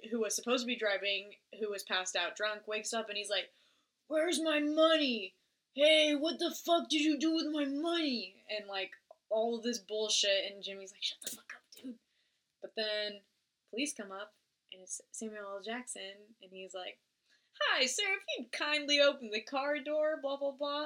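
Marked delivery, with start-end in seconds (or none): 4.1-8.4 higher, louder hammed-up drunk demanding his money; mock angry drunk. Retelling the movie, the drunk driver yelling about his money. 11.0-12.0 urgent hissed whisper; whispered hush. Retold or imitated. Jimmy hushing the drunk driver in a whisper. 17.6-20.9 higher, overly polite officer voice; mock polite cop. Retelling, Samuel L. Jackson as a cop asking politely.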